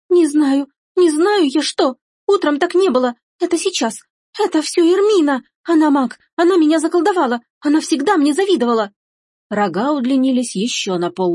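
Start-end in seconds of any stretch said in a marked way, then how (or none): 0.1-8.9 put-on high-pitched panicky girl; hysterical wail. Girl in tears, blaming sister. (истерично оправдывается, почти в слезах)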